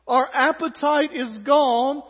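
slow, loud, drawn-out moan; milk the complaint like a drama; melodramatic whining. Acted. Israelites moaning over the manna, melodramatic. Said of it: "A little melodramatic".

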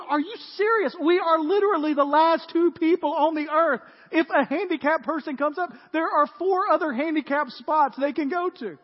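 loud, high-pitched incredulous rant; exasperated disbelief. Acted. Last man on earth arguing with the only woman, exasperated. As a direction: argue it hard, acting fed up. Acted exasperated argument over handicapped spot.

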